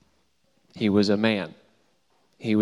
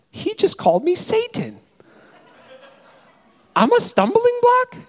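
much higher, stunned and wounded disbelief; Peter's hurt inner voice. Retold or imitated. Peter replaying Jesus' rebuke, high, stunned and hurt. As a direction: voice Peter reeling after being called Satan. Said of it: "how Peter may have felt after hearing those words".

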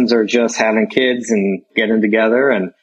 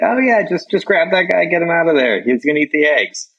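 mock casual local dude; 7 semitones higher than usual. Dude texting, voiced high.

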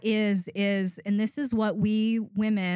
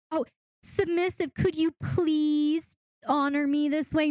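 much higher, sugary begging request; mock pandering plea; 6 semitones higher than usual. Coach mocks a timid dominant pleading with her submissive, high and sugary. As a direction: beg the submissive sweetly, mocking it. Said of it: "You have to go".

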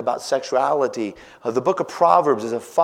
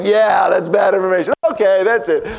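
louder, higher, half-shouted; loud reply; 6 semitones higher, 8 dB louder than usual. Retold or imitated. Dad loudly answering his daughter. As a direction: blurt it out loud.

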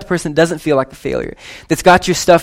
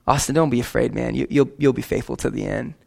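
soft, low, tender encouragement; softly voiced reassuring Jesus; gentle reassurance; 4 semitones lower, 8 dB quieter than usual. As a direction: comfort a frightened friend quietly. Imagining Jesus reassuring him.

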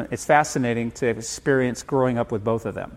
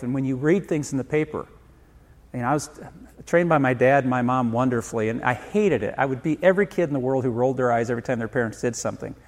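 ordinary talk

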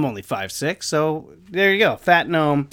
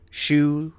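usual speaking voice

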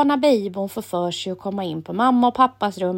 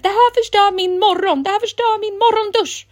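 much higher, louder protest; upset shouting; 12 semitones higher, 4 dB louder than usual. A narrator's reading. Emil in the bathroom protesting his ruined shower, loud and high. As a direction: complain loudly to everyone. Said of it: "ropar Emil".

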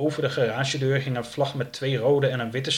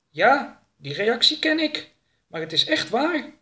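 much higher character voice; earnest alien; 12 semitones higher, 4 dB louder than usual. Acted. Oroka to his questioner about his planet, high insistence.

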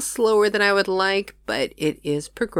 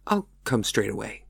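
a lightly lowered steady pastor; calm pastor, lower voice; 8 semitones lower, 4 dB quieter than usual. Acted. Pastor reassures his worried housekeeper, lower calm voice. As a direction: answer the housekeeper with quiet resolve.

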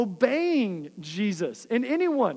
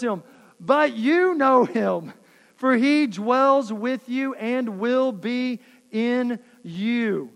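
scripture reading; 3 dB louder than usual. Acted.

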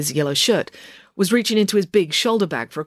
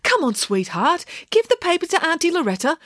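coaxing; 13 semitones higher than usual. A narrator's reading. Coaxing the dog.

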